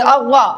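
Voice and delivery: अव्वा heavy and rounded, towards "aw"; मोटी आवाज. Shown as a demonstration. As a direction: fill the mouth on अव्वा. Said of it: "मोटी आवाज में बोलेंगे". (अव्वा को मोटी आवाज़ में)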